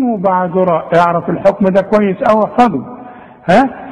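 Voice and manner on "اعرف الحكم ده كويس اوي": normal talking voice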